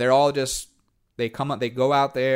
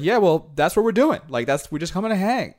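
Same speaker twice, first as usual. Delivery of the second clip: hunters' reply; 7 semitones higher, 3 dB louder than usual. Retold or imitated. Hunters answering him, quoted.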